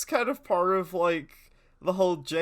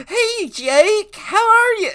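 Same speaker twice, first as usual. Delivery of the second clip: high, bright-voiced hello up high; sweet greeting; 8 dB louder, slower than usual. Adam sits down to greet Jake, sweet high hello. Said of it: "He's like".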